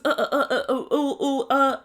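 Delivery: stuttered run of filler sounds; imitated nervous stammer. Binyam mimics Leandro to producers, imitated nervous stammer. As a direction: mimic someone floundering for an answer. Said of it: "really nervous when I asked him".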